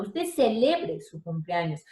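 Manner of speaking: slow phrase, CELEBRE as ce-LE-bre; middle-syllable stress. Shown as a demonstration. Teacher giving a grave word in a sentence, stress on LE. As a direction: put the force on LE in CELEBRE. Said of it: "Se quedó en el medio".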